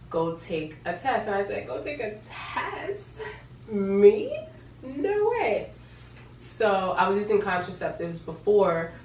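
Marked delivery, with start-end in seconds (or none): 1.7-5.7 high-pitched, drawn-out disbelief; squeaky astonished protest; incredulous echo. Retold or imitated. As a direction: repeat it back in mock shock, voice shooting up. Incredulous echo of boyfriend's instruction.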